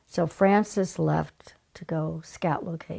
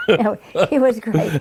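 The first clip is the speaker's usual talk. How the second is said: laughing out loud, louder and higher; 5 semitones higher, 8 dB louder than usual. Real, not acted. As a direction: burst out laughing while insisting. Laughing reply about Brando.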